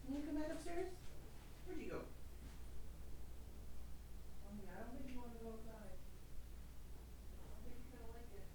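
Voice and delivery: high, soft voice for the cat; pet talk. Acted. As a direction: coo gently at the kitty. Talking softly to the cat.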